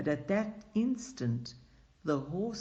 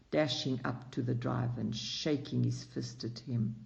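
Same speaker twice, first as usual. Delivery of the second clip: plain reading voice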